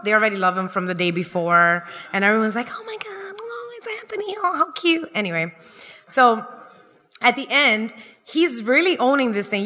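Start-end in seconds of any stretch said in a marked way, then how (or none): 2.7-5.1 high, hushed whispered coo; cooing crowd. Retold or imitated. Ceremony crowd doting on the little brother, high whispered coo. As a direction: gasp it softly like doting aunties.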